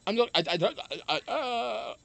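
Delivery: tripping over words; stuttering. Real, not acted. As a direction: stammer and restart. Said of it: "I'm stuttering John Melendez tonight".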